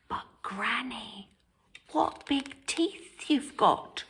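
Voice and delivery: Little Red whispering to the wolf. Acted. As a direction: barely breathe it out. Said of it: "whispered Little Red".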